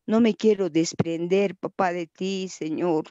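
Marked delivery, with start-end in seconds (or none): none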